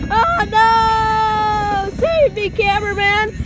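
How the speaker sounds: hammed-up panic. Riding the runaway wagon, begging the cameraman for rescue in hammed-up panic. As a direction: play a half-joking plea to be rescued.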